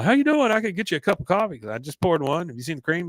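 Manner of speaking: high, hurried mock friendliness; imitated nervous chumminess; overeager niceness. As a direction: fall over yourself being helpful. Hank overboard trying to be nice.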